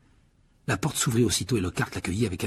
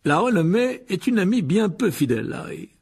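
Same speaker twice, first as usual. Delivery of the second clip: much higher, loud, laughing showman; Lockhart; 10 semitones higher, 4 dB louder than usual. A narrator's reading. Lockhart welcoming Harry to detention, loud and beaming. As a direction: gush with fake delight.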